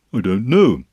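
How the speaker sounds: mildly glum bear; rather doleful. A narrator's reading. Pooh answering Piglet's question, rather doleful. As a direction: answer with a touch of gloom. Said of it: "said Pooh rather dolefully".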